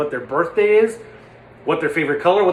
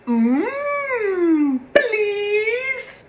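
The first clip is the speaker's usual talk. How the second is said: much higher, whimpering please; Chamberlain impression. Trigger word for the Chamberlain impression, high whining please. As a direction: plead like Chamberlain. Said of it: "For Chamberlain, it's".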